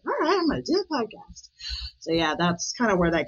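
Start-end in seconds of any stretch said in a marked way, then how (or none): 0.1-1.5 much higher, keen let's do it; spur-of-the-moment yes; eager go-ahead. Retold or imitated. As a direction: sign right up on impulse. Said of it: "I'm like".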